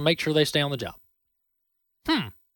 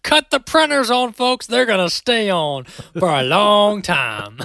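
mock official order; 3 semitones higher, 4 dB louder, slower than usual. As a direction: bark it like a giddy bureaucrat ordering the presses on. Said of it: "just tell the Treasury Department".

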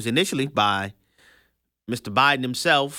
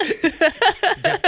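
high put-on teasing voice; mock voice; 4 dB louder than usual. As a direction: call his name in a high silly voice.

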